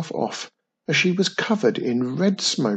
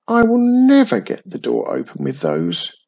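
higher, louder frustrated grumble; grumbling; 7 semitones higher, 5 dB louder than usual. A narrator's reading. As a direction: complain in mild exasperation. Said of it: "she grumbled".

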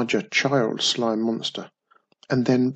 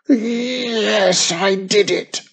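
forced confession; 8 semitones higher, 5 dB louder, much slower than usual. A narrator's reading.

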